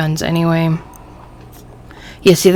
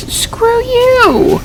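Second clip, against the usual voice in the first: sudden high squeaky "screw you"; a pitched-up cry of defiance; defiant outburst. Real, not acted. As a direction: snap back at the game in outrage. Yelling back at the game.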